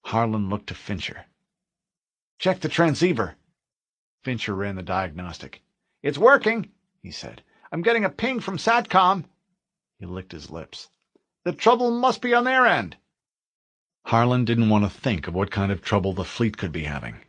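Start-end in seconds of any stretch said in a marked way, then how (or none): 2.4-13.0 lightly voiced Marines checking gear; tense Marines in a bunker; soldiers' voices.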